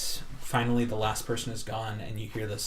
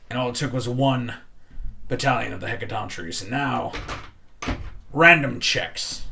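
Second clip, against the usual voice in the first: louder, building resentment; rising anger; 6 dB louder than usual. Acted. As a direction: let the anger swell. Bartender's angry rant.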